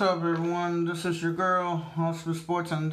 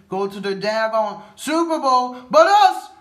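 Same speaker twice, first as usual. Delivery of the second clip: sudden loud frustrated yell on "but us"; frustrated outburst; 3 semitones higher, 9 dB louder than usual. Real, not acted. Fan venting about team's Super Bowl drought, angry yell.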